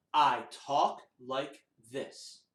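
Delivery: slow, low spoken words, spaced apart; talking voice. Shown as a demonstration. Teacher demonstrating talking voice to children, slow and spoken. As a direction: say it deliberately, word by word. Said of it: "We have our speaking voice".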